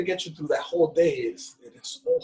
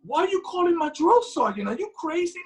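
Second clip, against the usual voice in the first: mock exasperation; 14 semitones higher than usual. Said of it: "I'm like".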